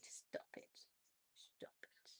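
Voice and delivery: whispered, barely audible command; quick aside under the breath, very soft; hushed telling-off. Real, not acted. Breaking off the chat to say stop it, whispered.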